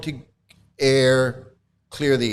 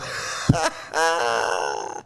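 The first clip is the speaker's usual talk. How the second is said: laughing; 4 semitones lower, 3 dB quieter than usual. Real, not acted. Laughing at his own impression.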